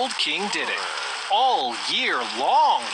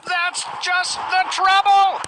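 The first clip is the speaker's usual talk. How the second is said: high, loud, slow bellow; 11 semitones higher, 5 dB louder, slower than usual. Acted. King bellows back at page boy, high and loud. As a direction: roar it like a cross king. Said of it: "bellowed the king".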